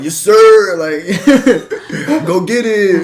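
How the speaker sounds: high, loud hyped bro with a laugh; hyping up his gym friend, loud frat-guy shout. Retold or imitated. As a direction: bellow encouragement like a frat bro. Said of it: "I sound like your typical frat guy".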